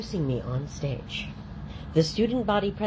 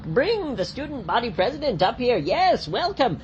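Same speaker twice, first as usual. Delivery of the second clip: big, raised showman voice; stage host; 7 semitones higher than usual. Pretend. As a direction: welcome them like a showman.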